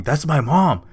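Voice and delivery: imitating someone getting worked up; mock hysterical. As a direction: act out mild hysteria, pitch and volume up. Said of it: "getting all hysterical".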